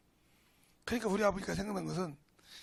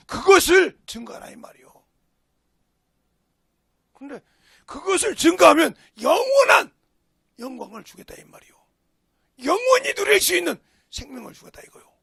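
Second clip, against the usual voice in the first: impassioned pulpit shout; full-force preaching; 8 semitones higher, 16 dB louder than usual. Real, not acted. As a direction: thunder out the command and promise.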